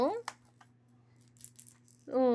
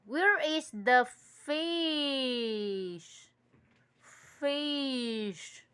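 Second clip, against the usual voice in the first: slow sing-song question; much slower than usual. Acted. Mother asking toddler in sing-song.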